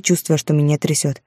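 ordinary delivery

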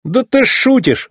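high, drawn-out indignant protest. A narrator's reading. Racer told to pull the paving machine, indignant. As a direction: cry out in disbelief. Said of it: "возмутился гонщик".

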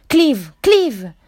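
desperate pleading yell; pleading shout. Acted. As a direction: call out, begging him to come back. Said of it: "urlò Jack implorante".

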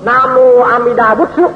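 "นาโมอามิดาบุตสุ" as Japanese na-mo a-mi-da-bu-tsu; Japanese-style nembutsu, "บุตสุ" clipped at the end; Japanese pronunciation. Shown as a demonstration. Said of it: "ถ้าว่าเป็นเสียงญี่ปุ่นก็".

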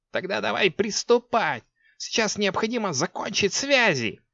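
high urgent witch voice; urgent sorceress. A narrator's reading. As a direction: press her like an impatient sorceress. Six pleased.

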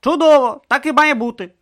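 high loud "Чудово"; pleased Urn. Acted. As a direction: approve warmly and loudly. Urn approving loudly.

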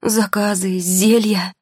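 растерянно перечислять, выше и громче; растерянный протест повышенным голосом; растерянность. A narrator's reading. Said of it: "Маргарита откровенно растерялась". (растерянно)

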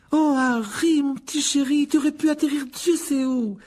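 very high, loud, fussing motherly voice; fretting mother. Acted. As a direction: gush with worry over a found child. Worried mother finds the lost boy. (mère affolée, voix très aiguë)

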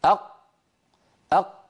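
أق with a hard closed qaf stop; stopped qaf. Shown as a demonstration. Teacher demonstrating أق with a closed stop for students. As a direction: say أق with the qaf fully shut, no release. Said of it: "حاولوا أن تضغطوا قليلًا".

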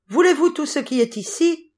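high-pitched storybook shout, held in; full-force cry. A narrator's reading. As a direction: pipe it out high, a moderate yell. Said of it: "crier de toutes ses forces".

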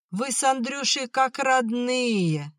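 high, drawn-out sugary lady; mocking mimicry. A narrator's reading. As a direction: mimic the mistress in a slow high singsong. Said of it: "передразнил он". (передразнивая барыню)

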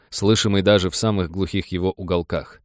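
ordinary delivery, nothing marked

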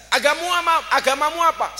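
loud, high-pitched demand; loud questioning. Acted. Prophet questioning a would-be convert, loud questioning. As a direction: fire the question out, high and forceful.

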